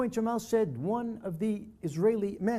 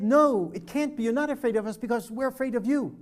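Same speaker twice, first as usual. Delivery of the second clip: sharp, higher and louder disbelief; indignant, raised no; incredulous denial; 4 semitones higher, 5 dB louder than usual. Retold or imitated. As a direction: refuse to accept it outright. Jamal rejects Hanan's claim.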